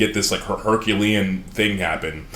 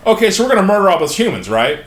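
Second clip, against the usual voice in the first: fast, high, loud eager question; eager prince; 9 semitones higher, 5 dB louder, faster than usual. Retold or imitated. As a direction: blurt it out gleefully, racing and loud. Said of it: "was like".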